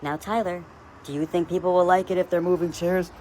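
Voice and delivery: lightly voiced sweet-talking mom, mock patient; sugary mock-patient parent voice, teasing. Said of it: "You're like".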